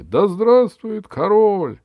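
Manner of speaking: loud, high, slow hurrah; cheering. Acted. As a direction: shout it like a crowd. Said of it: "крики". (ликующий крик)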